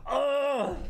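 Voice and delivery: retching groan of disgust; disgusted gag. Real, not acted. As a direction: gag on a nasty taste. Gagging on a vile jelly bean.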